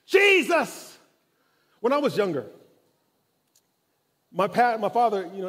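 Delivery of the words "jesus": high, loud, slow cry for mercy; desperate shout. Acted. Blind man crying out to Jesus over the crowd, loud and high. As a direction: shout it out, stretched and pleading. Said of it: "Ah, it's different".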